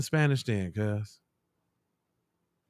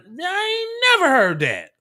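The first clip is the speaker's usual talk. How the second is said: incredulous outburst; 13 semitones higher, 4 dB louder than usual. Real, not acted. Incredulous reaction.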